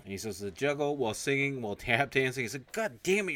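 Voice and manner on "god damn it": high, louder exasperated burst; mock frustrated curse. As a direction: blurt it out in frustration. Officer frustrated outburst.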